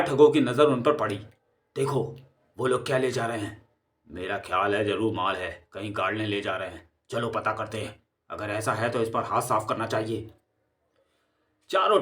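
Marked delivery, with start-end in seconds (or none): 1.8-10.3 low, soft conspiratorial voices; hushed plotting. Acted. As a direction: whisper the plan so nobody hears. Thugs plotting in hiding.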